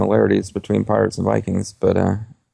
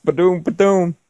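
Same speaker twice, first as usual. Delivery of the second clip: rimshot; 10 semitones higher, 4 dB louder, slower than usual. Pretend. Rimshot after joke.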